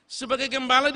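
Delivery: ringing pulpit refrain; full-force preaching. Acted. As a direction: launch into the climax. Same phrase, loud preaching.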